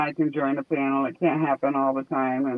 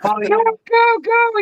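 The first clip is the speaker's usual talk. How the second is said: much higher, urging go go go; yelling at the screen for the runner; excited cheering; 4 dB louder, slower than usual. Real, not acted. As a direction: cheer him on as he runs.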